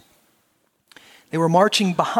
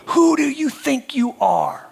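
high, slow, mild roar; slow, pointed roar at heaven; 8 semitones higher, 3 dB louder, slower than usual. Acted. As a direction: demand it of God. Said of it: "she roared".

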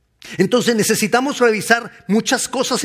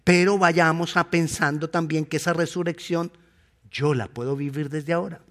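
ordinary delivery

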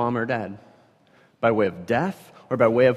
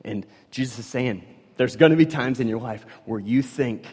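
ordinary delivery

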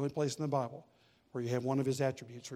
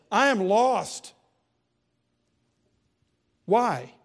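higher, louder fiery preaching; preaching at full force; 6 semitones higher, 9 dB louder than usual. Acted. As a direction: thunder Isaiah's despair at the congregation.

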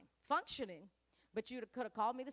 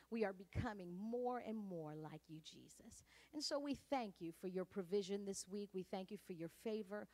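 lower, softer, reverent prayer voice; closing prayer; 4 semitones lower, 4 dB quieter than usual. Real, not acted. Pastor closing the service in prayer, soft and reverent. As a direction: pray gently and gratefully.

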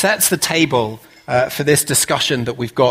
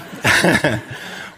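short amused chuckle; brief self-amused laugh. Real, not acted. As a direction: chuckle at your own joke. Laughing after the accent joke.

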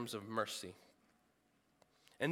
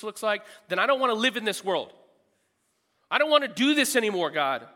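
raised, building to a fast loud cry of giving up; Jonah's despairing outburst; 6 semitones higher, 7 dB louder than usual. Acted. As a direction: push harder until he breaks off. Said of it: "so dissatisfied with the world".

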